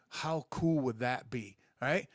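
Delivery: normal talking voice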